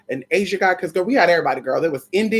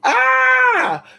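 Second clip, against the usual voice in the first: loud high shriek; loud cry; 5 dB louder than usual. Real, not acted. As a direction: let out a piercing yell. Sudden outburst.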